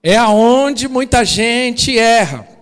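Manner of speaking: slow, loud warning shout; preacher shouting the common mistake; emphatic shout. Real, not acted. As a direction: hammer the point home. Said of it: "vou ensinar um negócio aqui".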